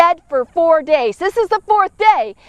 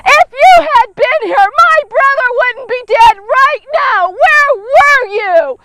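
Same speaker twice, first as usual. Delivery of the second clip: higher, louder accusing outburst; letting him have it; 6 semitones higher, 4 dB louder than usual. Acted. Grieving Mary confronting Jesus, higher and louder in anger. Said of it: "she just lets Jesus have it".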